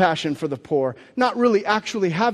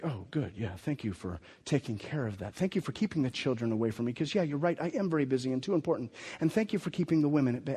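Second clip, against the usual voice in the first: softer, quick, dripping sarcasm; mock grateful Jesus; 5 semitones lower, 10 dB quieter, faster than usual. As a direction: play the praise they wish for, tongue in cheek.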